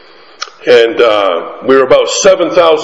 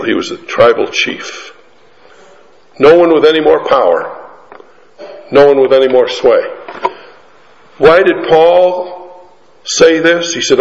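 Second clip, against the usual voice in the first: ordinary delivery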